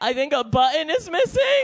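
put-on high, quick little-kid voice; child translator. Small child softening mom's rant for the cashier, high polite chirp. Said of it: "And I'm like".

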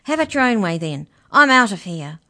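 loud panicked outburst; Mallory shouting in panic before fleeing. Acted. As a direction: blurt it and bolt. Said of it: "gasped, on the verge of panic".

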